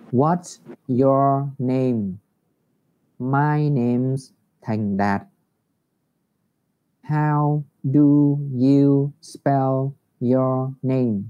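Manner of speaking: very slow, deliberate teacher demo; slow English model; slow model reading. Shown as a demonstration. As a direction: stretch every word for beginners. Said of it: "thầy đọc lại chậm cho cả lớp".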